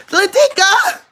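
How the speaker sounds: very high, loud mama call. Real, not acted. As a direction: shriek her name like a summoning mother. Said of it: "my mama voice".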